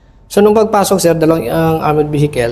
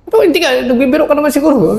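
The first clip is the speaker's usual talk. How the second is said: retold disbelief; 10 semitones higher, faster than usual.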